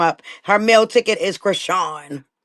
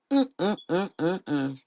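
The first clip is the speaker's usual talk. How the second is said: disapproving hum; 10 dB quieter than usual. Real, not acted. Disapproving hum after rant.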